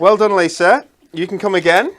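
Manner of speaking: loud bright cheering; cheerful praise. Real, not acted. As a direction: cheer her on. Praising absent Lisa.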